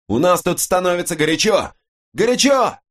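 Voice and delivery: very high, desperate radio yell; shouting under fire. A narrator's reading. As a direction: scream it over gunfire. (отчаянный крик под обстрелом)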